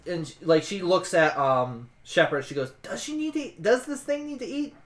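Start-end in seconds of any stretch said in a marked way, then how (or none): 2.8-4.8 high-pitched, incredulous asking; baffled question. Acted. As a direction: pitch it up in disbelief. Character puzzled about the scarecrow.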